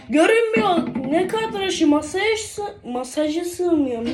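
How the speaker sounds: high, loud baffled exclaim; surprised outcry. Real, not acted. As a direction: sound amazed it doesn't fit. Can't see the table, surprised.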